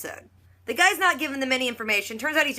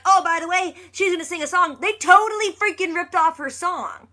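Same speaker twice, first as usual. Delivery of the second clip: higher and louder, real indignation building; outraged excitement; 8 semitones higher, 5 dB louder than usual. Real, not acted. Vlogger telling viewers the rivals stole Claire's song, outraged. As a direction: blurt it out, fired up about the theft.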